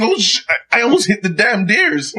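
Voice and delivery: shaken relief. Retold or imitated. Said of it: "and saying".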